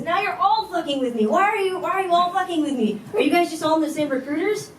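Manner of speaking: high, exasperated retold questioning; incredulous accusation. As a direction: accuse the whole group in mock outrage. Man accuses her friends of lying.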